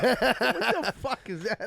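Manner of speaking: high, slow disbelieving outburst; shocked what-the-hell reaction; incredulous. Real, not acted. Reacting to coke joke.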